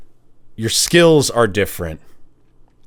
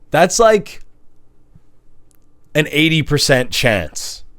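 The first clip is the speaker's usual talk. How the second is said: ordinary delivery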